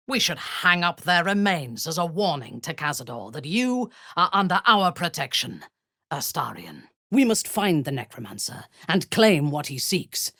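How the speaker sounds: normal talking voice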